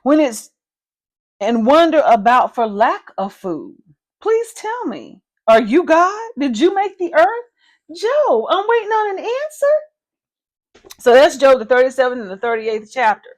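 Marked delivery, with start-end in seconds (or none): 4.2-10.9 high, slow mocking challenge; sarcastic God. God demanding Job's answer, high and mocking. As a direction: press Job like a teasing father waiting. Preacher voices God taunting Job.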